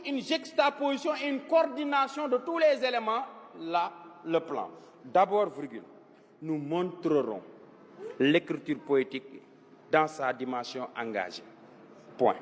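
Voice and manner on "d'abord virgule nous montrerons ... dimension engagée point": low, measured dictation with pauses between chunks. Shown as a demonstration. Teacher dictating an essay plan to pupils, measured dictation. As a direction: dictate it phrase by phrase, pitched low.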